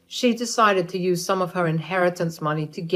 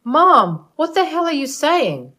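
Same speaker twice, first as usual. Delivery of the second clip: much higher, loud disbelieving cry; shocked outburst; 9 semitones higher, 6 dB louder than usual. Acted. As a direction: blurt it out, appalled at your mother. Son shocked at mother's insult.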